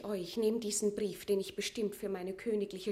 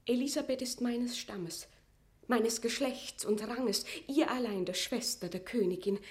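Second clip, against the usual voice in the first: ordinary talk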